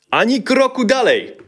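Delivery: high, loud threatening yell; bandit shout. A narrator's reading. Bandit shouting at father and son. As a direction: shout a threat to stop them. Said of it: "krzyknął bandyta".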